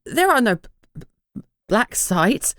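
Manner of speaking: nervous clerk stuttering on each b; stammering. A narrator's reading. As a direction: trip over the words, frightened. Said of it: "The clerk paled and stammered".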